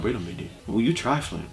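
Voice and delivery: muttered disapproval. Retold or imitated. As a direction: mutter it under your breath, judging. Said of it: "I was just like".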